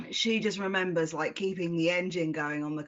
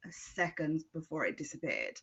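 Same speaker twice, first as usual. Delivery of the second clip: ordinary talk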